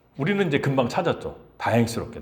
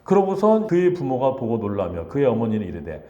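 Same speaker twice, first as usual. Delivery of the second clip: usual speaking voice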